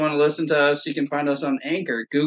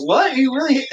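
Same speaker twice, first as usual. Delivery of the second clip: high, shocked disbelief; surprised; 10 semitones higher than usual. Retold or imitated. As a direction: gasp it out in disbelief. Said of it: "and I'm like".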